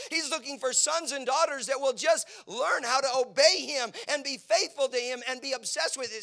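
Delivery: loud, high preacher's cry; fiery preaching. Acted. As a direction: preach it at full force. Preaching at full force.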